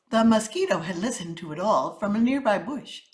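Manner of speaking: usual speaking voice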